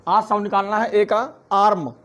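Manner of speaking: normal talking voice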